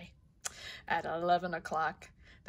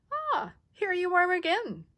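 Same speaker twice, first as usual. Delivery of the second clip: friendly high ladybug voice; 11 semitones higher, 3 dB louder than usual. Acted.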